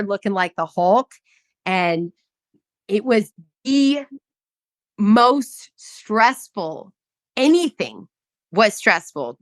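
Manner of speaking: ordinary delivery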